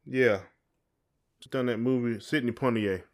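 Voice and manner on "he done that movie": ordinary delivery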